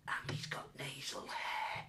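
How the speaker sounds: slow, hissy stage whisper. Retold or imitated. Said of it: "she whispers me".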